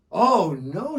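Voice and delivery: loud, high sly protest; Joha voice. Acted. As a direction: protest with fake sadness. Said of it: "said Joha".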